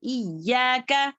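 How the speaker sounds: इय्याका leaning hard on the doubled Y; pressed doubled ya. Shown as a demonstration. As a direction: press and hold the य्य in इय्याका. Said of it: "शद्दा लग जाता है तो दो बन जाता है".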